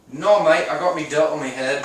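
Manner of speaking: a light British accent, imitating a coworker. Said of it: "And he was British".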